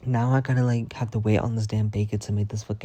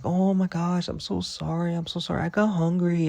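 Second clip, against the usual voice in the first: high apologetic mock voice of Nora; mimicking Nora; 8 semitones higher than usual. Nora apologizing for eating the bacon, high mocking voice. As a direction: squeak out a sorry like a guilty friend. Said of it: "Then Nora gonna say".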